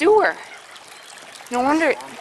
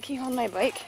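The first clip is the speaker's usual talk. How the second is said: usual speaking voice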